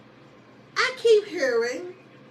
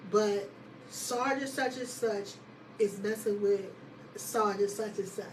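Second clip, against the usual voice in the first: lower, measured retold confession; confiding report; 4 semitones lower, 6 dB quieter than usual. Soldier reporting to her sergeant, low confiding voice. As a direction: relay it low and carefully.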